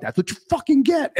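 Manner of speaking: high, triumphant taunt; gloating taunt. Retold or imitated. Gloating at the angler at table.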